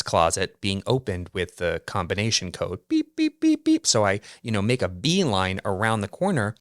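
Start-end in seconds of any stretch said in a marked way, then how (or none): 2.9-3.9 high chirpy beep noises, mimicking the keypad; imitated keypad beeps. Narrator mimics the maintenance closet keypad, high beeping.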